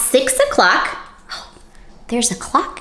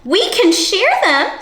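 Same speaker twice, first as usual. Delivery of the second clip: much higher, slowish, kindly offer; friendly ladybug; 10 semitones higher, 4 dB louder than usual. Acted. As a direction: suggest it sweetly like a tiny helpful bug. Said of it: "suggested the friendly ladybug".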